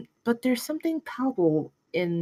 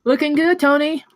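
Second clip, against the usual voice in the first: cheery greeting; 7 semitones higher, 6 dB louder than usual. Acted. Peggy greets Tony brightly.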